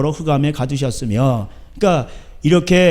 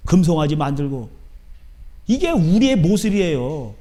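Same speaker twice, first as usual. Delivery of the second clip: ordinary talk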